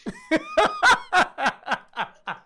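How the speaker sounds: high burst of laughter; loud laugh. Real, not acted. Big laugh at the joke.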